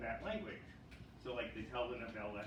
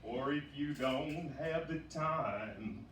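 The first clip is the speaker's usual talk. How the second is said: slow, louder [name] voice; [name] impression; 3 semitones higher, 8 dB louder, slower than usual. Clinic receptionist greeting patients as a slow, louder [name]. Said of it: "I love the [name] dialect".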